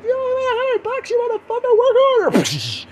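high, loud mock-angry screech; hammed-up raging mother; abusive mom. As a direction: berate in an exaggerated falsetto. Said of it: "some moms that, like, give you a punch".

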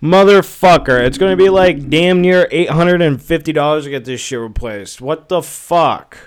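loud, exasperated swearing at the bill; annoyed outburst. Real, not acted. Vlogger fuming out loud at his car repair bill, loud and exasperated. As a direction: blow up loudly over the repair cost. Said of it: "I am so annoyed right now".

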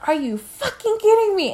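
retold angry yell. Confronting cheating boyfriend, retold.

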